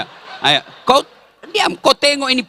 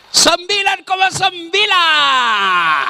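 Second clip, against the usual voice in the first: excited score announcement; triumphant score call; 11 semitones higher, much slower than usual. Real, not acted. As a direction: call out the top score like a judge.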